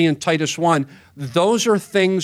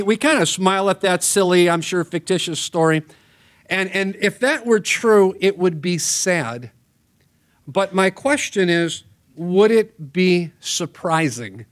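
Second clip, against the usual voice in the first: ordinary delivery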